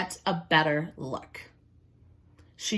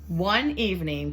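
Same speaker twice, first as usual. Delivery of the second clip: ordinary delivery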